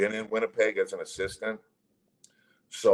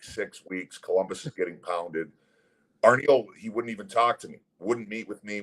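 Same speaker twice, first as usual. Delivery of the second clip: usual speaking voice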